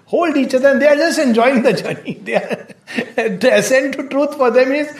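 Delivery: high-pitched talk breaking into laughter; chuckling while telling the story; amused laughing. Real, not acted. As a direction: laugh through the words in real amusement. Amused by the lovers in play.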